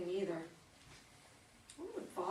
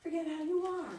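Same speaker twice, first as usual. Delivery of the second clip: louder, higher, snapping with frustration; frustrated outburst; 7 semitones higher, 8 dB louder than usual. Acted. Daughter snapping at her forgetful mother, frustrated and loud. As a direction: let the hurt burst out sharply. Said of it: "You're not mad at me?".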